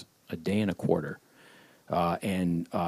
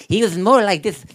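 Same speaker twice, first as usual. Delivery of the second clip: high, louder strained iguana throat voice; Cecil Iguana voice; 10 semitones higher, 8 dB louder than usual. Retold or imitated. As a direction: squeeze it through a lizard throat. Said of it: "iguana kind of throat thing".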